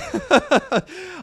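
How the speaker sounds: high, loud cackling laugh; embarrassed laughing fit; loud burst of laughter. Real, not acted. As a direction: crack up at yourself. Laughs at his own mix-up.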